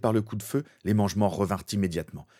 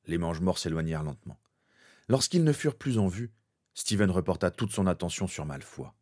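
ordinary delivery, nothing marked

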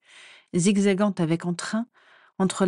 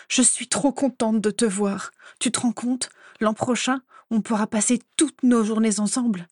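bubbly, higher-pitched greeting; thrilled young friend gushing; excited delight; 3 semitones higher than usual. A narrator's reading. Childhood friend greets her after two years, excited.

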